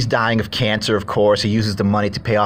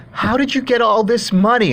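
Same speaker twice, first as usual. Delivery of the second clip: high mock wife's question; 12 semitones higher than usual. Dying ex-wife asks husband about the money, high mock voice. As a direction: pitch it up like a curious wife.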